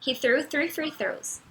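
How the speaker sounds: practice-speed "threw three free throws" with TH against F; careful tongue twister. Shown as a demonstration. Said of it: "dois trava-línguas pra vocês treinarem a pronúncia".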